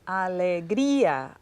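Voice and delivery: "Alegría" slowly, with "grí" held long; stress on GRÍ. Shown as a demonstration. Student answering where the emphasis falls, stress on GRÍ. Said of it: "¿Dónde escuchamos el énfasis?".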